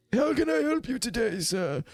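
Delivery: put-on high old man's voice, falling away at the end. Old magistrate offers the commander help, high elderly voice. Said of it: "as this old man looks, is human, very old".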